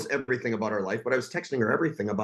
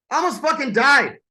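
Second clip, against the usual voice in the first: angry outburst; 10 semitones higher, 5 dB louder than usual. Real, not acted. Answering his online haters.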